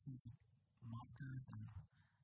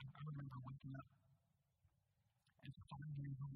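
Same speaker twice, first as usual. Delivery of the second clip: ordinary talk, nothing marked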